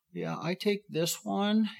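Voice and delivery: put-on high, slow old lady voice; grandma showing the firefighter her pills, slowly and high.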